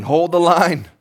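loud, high military command; rally the troops; shouting the order in a war movie; battle shout. Acted. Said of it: "someone's shouting".

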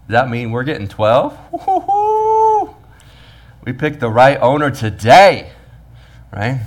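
giddy worker expecting a windfall; mock excitement. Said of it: "the workers are like".

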